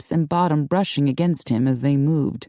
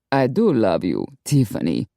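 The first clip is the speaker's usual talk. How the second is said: lower, smooth lover's reply; a smooth Latin charmer; suave lover; 7 semitones lower than usual. A narrator's reading. Don Orlando soothing Tiffany, lower smooth voice.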